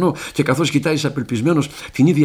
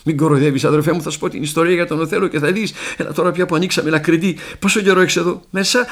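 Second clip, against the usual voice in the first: raised, high, eager voice; excited pleading; 5 semitones higher, 4 dB louder than usual. Acted. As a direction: pour it out loudly to a newfound brother. Excited pleading with new friend.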